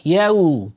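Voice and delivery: "ইয়াউ" as yae-u, closing on a clear u; short u ending. Shown as a demonstration. Teacher giving the correct example, "ইয়াউ" with final u. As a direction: say "ইয়াউ" with a thin vowel gliding into u. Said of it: "রস্য উর মতো করে উচ্চারণ হবে".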